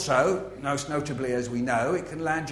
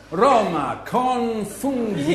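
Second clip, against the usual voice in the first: slow, drawn-out tourist Italian; halting phrasebook tourist; 5 semitones higher, 4 dB louder, much slower than usual. Pretend. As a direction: plead Roma con funghi to a policeman. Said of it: "and you say".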